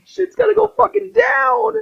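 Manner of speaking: high, squeaky, disbelieving rant; incredulous Ezio. Retold or imitated. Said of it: "And it's like".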